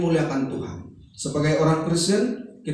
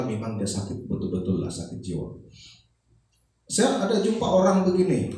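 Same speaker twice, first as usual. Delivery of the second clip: ordinary talk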